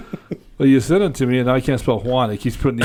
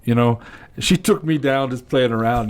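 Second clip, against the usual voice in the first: normal talking voice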